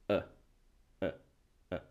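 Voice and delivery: "E" said as a short, weak "uh"; schwa sound. Shown as a demonstration. Teacher demonstrates the upside-down e symbol, weak schwa. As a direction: let "E" drop out as a neutral uh. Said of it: "el sonido de este".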